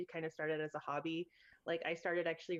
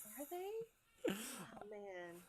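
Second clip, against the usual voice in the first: soft surprise; 4 semitones higher, 7 dB quieter, much slower than usual. Real, not acted. Hears reboot news.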